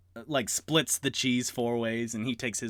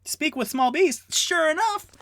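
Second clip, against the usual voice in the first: high, loud triumphant read; excited; 6 dB louder than usual. Real, not acted. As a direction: cheer finding the rule checks out. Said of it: "I just wanna make sure that's legit".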